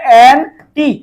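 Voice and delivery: Anti split in two, an, pause, টি; stress on টি. Shown as a demonstration. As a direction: pull anti apart so the টি stands alone. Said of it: "টি কথাটা বেশি উচ্চারণ হচ্ছে". (Anti ভেঙে, টি আলাদা করে জোরে)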